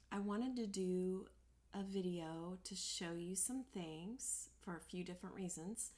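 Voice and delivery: ordinary delivery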